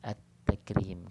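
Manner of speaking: "At-Takrim" with a doubled T and no L; lam merged into ta. Shown as a demonstration. Teacher repeating the example, "At-Takrim" with silent L. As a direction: hold the T closure in "At-Takrim". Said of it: "kita baca At-Takrim".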